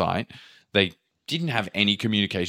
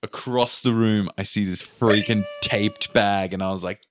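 usual speaking voice

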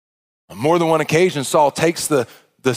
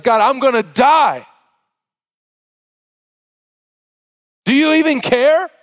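desperate shout; 8 semitones higher, 3 dB louder than usual. Acted. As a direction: cry out that you are about to die. David despairs of death, shouted.

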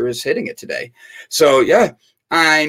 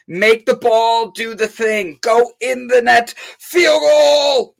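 rising higher and louder to a cheer; mock field goal cheer; 8 semitones higher, 4 dB louder, slower than usual. Hosts cheering the ball into the net, building to a shout. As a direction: cheer the kick home like a fan.

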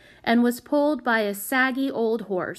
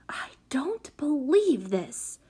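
slow, hushed, disbelieving snarl; high, drawn-out angry whisper; 6 semitones higher, slower than usual. A narrator's reading. Prudence confronting the runaway child, snarling. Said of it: "snarled Prudence".

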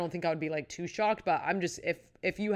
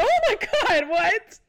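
high, loud gasp of disbelief; sudden high loud shocked cry; shocked shriek; 9 dB louder than usual. Retold or imitated. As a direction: squeal it like you can't believe it. Shocked reaction retold on a show.

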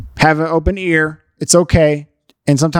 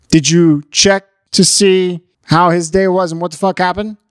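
ordinary talk, nothing marked

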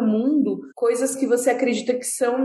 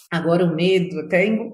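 choked mid-sentence; words catching in the throat; choking on a drink; 5 semitones lower than usual. Real, not acted. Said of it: "engasguei aqui".

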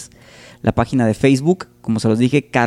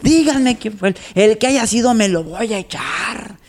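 much higher fluttery echo of the demand; flustered camp guy; 8 semitones higher than usual. Retold or imitated. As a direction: repeat the threat in a delicate high voice. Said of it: "todo alterado, así de".

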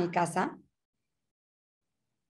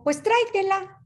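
high, lightly acted husband ordering; husband's order; 11 semitones higher, 3 dB louder than usual. Husband ordering his wife over the phone, high put-on voice. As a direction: voice the husband telling her to take the baby. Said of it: "Le dijo".

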